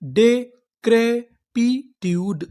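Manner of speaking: very slow, each syllable drawn out; डेक्रेपिट्यूड stretched syllable by syllable. Shown as a demonstration. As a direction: sound out डेक्रेपिट्यूड slowly. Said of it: "हमारी पूरी meaning क्या बनी".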